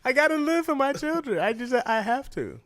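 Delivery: put-on high, loud, whiny plea to live for my children; mock pleading excuse. Hammed-up vegan pleading to fans, high and whiny. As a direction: pitch it way up like a hammed-up martyr.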